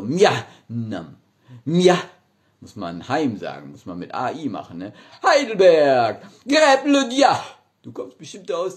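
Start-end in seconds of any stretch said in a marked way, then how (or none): 0.0-2.0 backwards speech. Acted. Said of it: "rückwärts". 5.2-6.2 slow, high, loud shout. Acted. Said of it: "warum ich so schreie immer". 6.5-7.4 shouted city name backwards; backwards speech. Acted. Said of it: "rückwärts".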